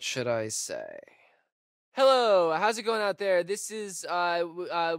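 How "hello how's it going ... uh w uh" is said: high, louder, hesitant call out; radio distress call. Acted. Broadcasting on the booster for help, a high nervous call.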